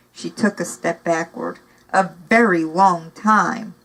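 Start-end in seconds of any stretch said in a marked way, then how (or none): 1.9-3.7 slow, weighted words, each one leaned on; emphasizing. Acted. Villain presses the point to the frightened girl, slow emphatic. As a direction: drag it out to make it sink in. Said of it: "Basta emphasized the word".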